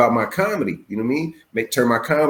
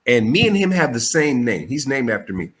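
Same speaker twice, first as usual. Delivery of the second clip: ordinary talk, nothing marked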